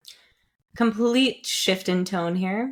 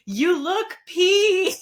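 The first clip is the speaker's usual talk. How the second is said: loud, high, drawn-out call-out; re-enacted teacher's slip, stretched and raised; retold blurt; 10 semitones higher, 5 dB louder, much slower than usual. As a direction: call it out slowly to a whole choir. Retold blurt while stopping the choir.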